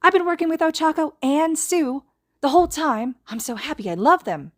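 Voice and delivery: put-on high, bubbly girlish voice; overjoyed chatter; giddy gushing. A narrator's reading. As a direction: gush with delight, voice way up. Himiko gushing, twirling around.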